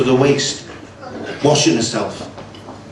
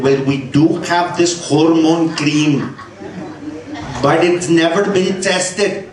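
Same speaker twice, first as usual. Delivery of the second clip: mock Pakistani accent; slower than usual. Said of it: "Pakistani specialists".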